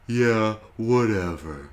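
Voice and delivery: yawning. Acted. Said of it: "Rory yawns".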